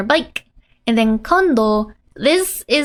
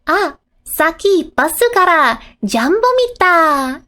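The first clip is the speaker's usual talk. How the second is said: put-on child voice; 4 semitones higher, 3 dB louder than usual. Reading child's line.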